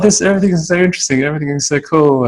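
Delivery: high, fast gushing wonder; imitating his own starry-eyed excitement in Japan; excited tourist. Retelling his first Japan trip to the interviewer, high and fast gushing. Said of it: "I was like".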